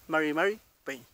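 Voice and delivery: MARI MARI PEÑI with untrilled Mapuche r; correct Mapuche form. Shown as a demonstration. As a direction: model MARI MARI PEÑI with English-like r. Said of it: "Esa es la forma de pronunciar".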